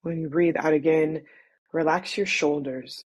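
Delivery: measured, soothing body-scan guidance; guided meditation. Acted. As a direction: ease them into noticing their breath, unhurried.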